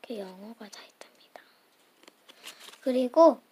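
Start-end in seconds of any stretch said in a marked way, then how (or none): 0.0-1.4 whisper. Real, not acted. Hushed aside while showing.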